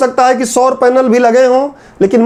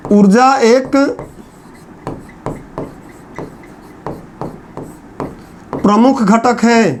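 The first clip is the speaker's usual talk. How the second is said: slow dictation; slower than usual. Shown as a demonstration. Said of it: "बनाते रहो मेरे साथ".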